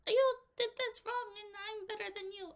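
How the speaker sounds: high, haughty scolding; snooty customer. Pretend. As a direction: lord it over the worker. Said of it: "the other person's like".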